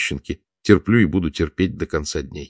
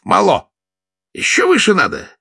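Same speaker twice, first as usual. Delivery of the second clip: high, louder impatient shout; 5 dB louder than usual. A narrator's reading. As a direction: bark it from the director's table. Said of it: "закричал Охлопков".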